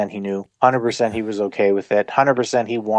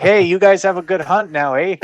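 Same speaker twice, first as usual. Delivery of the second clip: loud, raised, exaggerated Canadian accent; cheery over-the-top Canadian send-off; hammed-up Canadian; 8 semitones higher, 4 dB louder than usual. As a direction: lay the Canadian on thick for the visitors. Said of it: "hamming up that Canadian shit".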